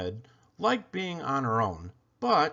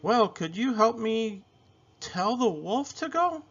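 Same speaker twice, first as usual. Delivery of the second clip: higher, lightly voiced little girl; a curious little girl, pitched up; girl's voice; 9 semitones higher than usual. A narrator's reading. Isabel finds something inside the box, higher girl voice.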